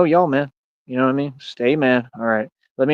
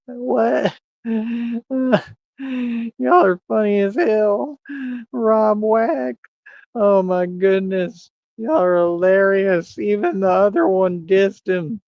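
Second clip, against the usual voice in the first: laughing; 9 semitones higher, slower than usual. Real, not acted. Still laughing at the chat.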